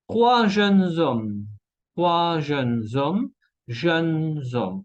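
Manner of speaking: jeunes hommes linked with a z; liaison in Z. Shown as a demonstration. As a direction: draw out "jeunes hommes" slowly as jeune-zommes. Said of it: "La S se cambia en Z".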